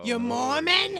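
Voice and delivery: high, shocked Brooklyn mom; outraged Brooklyn mother. Retold or imitated. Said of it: "my Brooklyn mom".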